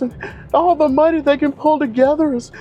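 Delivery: high falsetto whiny plea; mock crying agent. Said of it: "literally crying".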